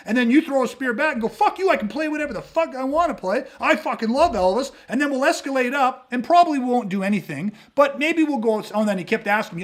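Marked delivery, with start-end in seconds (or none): 1.3-4.7 high-pitched, indignant comeback; acted-out defiant retort. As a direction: throw it back in mock outrage. Acted-out retort in bar quarrel.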